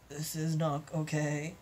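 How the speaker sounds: ordinary delivery, nothing marked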